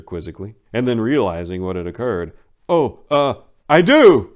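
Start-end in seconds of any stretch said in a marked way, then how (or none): 2.7-4.3 startled blurt. Acted. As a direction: caught off guard, then a loud, high I do. Startled groom blurts his vow.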